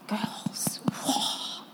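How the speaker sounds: real awe, almost whispered; hushed awe. Real, not acted. Preacher to the girls, struck by how massive it is, hushed. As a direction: breathe it out softly, overwhelmed. Awed aside to the congregation.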